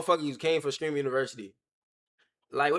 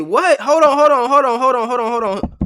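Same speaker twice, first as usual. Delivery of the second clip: alarmed protest; 6 semitones higher, 9 dB louder than usual. Retold or imitated. Retold shocked protest to interviewer.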